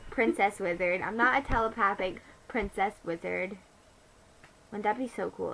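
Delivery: ordinary talk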